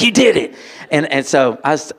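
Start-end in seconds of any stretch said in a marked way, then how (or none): 0.0-0.6 higher, bright burst of pride; excited praise. Retold or imitated. Tutor praising Dobie, excited and higher. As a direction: celebrate him out loud. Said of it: "I was like".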